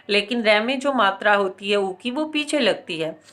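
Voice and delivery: ordinary delivery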